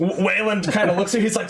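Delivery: usual speaking voice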